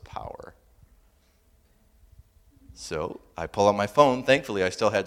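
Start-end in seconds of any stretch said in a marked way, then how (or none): 0.0-0.5 whispered punchline. Acted.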